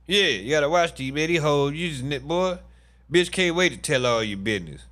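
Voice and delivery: ordinary delivery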